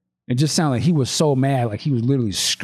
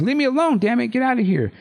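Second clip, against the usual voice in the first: mock shriek; 10 semitones higher, faster than usual. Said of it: "and she shrieks".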